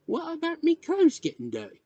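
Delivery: put-on small boy's high, worried question; worried young boy. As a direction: ask it high like an anxious child. Worried boy asks about his clothes.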